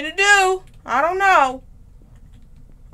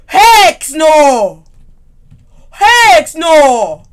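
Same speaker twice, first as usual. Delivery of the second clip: yelling no at the screen, loud; panicked shout; 11 dB louder, much slower than usual. Real, not acted. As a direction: scream it out in fright. Scared gamer shouting at horror game.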